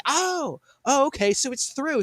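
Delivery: high-pitched "oh, I get it"; mock realization. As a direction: act out a learner suddenly catching on. Acts out learner catching on.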